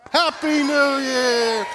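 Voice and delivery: loud, slow, high celebratory cheer; new year countdown crowd. Pretend. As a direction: shout it like midnight in Times Square. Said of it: "we're just gonna say Happy New Year's".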